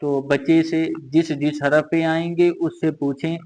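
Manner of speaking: usual speaking voice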